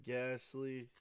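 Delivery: ordinary talk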